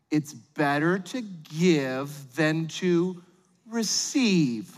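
very slow, drawn-out saying; parental platitude. Acted. Parent consoling little Billy about presents, slow and deliberate. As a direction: stretch out every word like a solemn lesson. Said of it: "here's how it sort of plays out".

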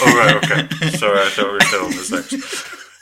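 loud, amused mock apology; laughing apology. Real, not acted. Co-host owning up to a misunderstanding, laughing apology. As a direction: burst out with it through laughter.

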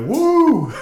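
a sudden very high, loud pity "Woo!" from the audience; pity cheer. Retold or imitated. Lone audience member pity-cheers a nervous performer, high loud whoop. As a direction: cheer out of sympathy, squealing it high. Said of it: "someone who feels really bad for you".

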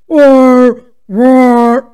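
loud playful roar imitating an excited dog. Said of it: "Really, it sounds like".

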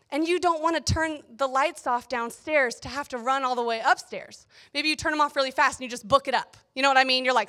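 ordinary talk

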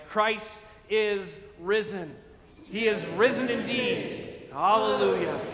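slow proclaimed call rising to a loud Hallelujah; Easter acclamation. Real, not acted. Pastor leading the Easter greeting, proclaiming loudly. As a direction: lift it up like a shout of praise.

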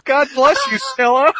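high, loud, laughing; amused. Real, not acted. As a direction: bless her through laughter. Laughing blessing after sneeze.

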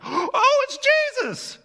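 high, loud gasp of discovery; shocked recognition. Acted. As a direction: blurt it out as the truth hits. Disciples recognize Jesus at bread.

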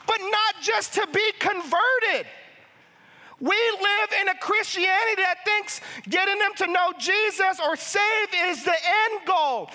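high-pitched, impassioned exhortation; real fervor, pleading with the congregation; passionate preaching. Real, not acted. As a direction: preach it with rising urgency, voice pushed up high. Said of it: "I'm sorry, I'm passionate".

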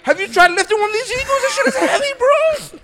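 high, complaining adviser voice; exasperated adviser. Retold or imitated. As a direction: whine about the heavy eagles. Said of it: "and be like".

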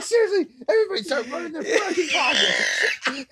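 very high-pitched imitated outburst; mock frantic demand. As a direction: squeal out the demand as a movie impression. Acting out movie character's demand.

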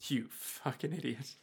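very soft, deadpan insult; muted contempt. Acted. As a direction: mutter it under the breath. Voices Huey's contemptuous reply.

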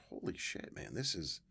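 whispered, breath-only amazement; awestruck hush. Retold or imitated. Young player standing before his heroes, hushed in awe. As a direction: drop to a soft, awed undertone.

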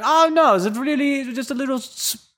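high-pitched mock German lady; German accent. German doctor reassuring her patient, high German accent. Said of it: "this really nice German lady".

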